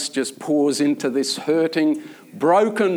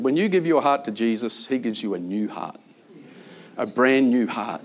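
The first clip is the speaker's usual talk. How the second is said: tender reassurance; 6 semitones lower, 4 dB quieter than usual. Retold or imitated. Comforting a despairing prisoner.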